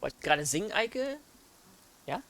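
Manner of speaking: ordinary talk, nothing marked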